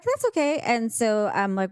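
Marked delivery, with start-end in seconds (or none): 0.0-0.6 much higher, breezy mock reply; easygoing guy, lightly voiced; imitating boyfriend.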